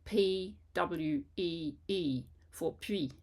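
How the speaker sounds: P W E E spoken slowly, letter by letter; slow spelled-out sound. Shown as a demonstration. Teacher slowly spells the textbook's wrong sound. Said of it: "so they'll write".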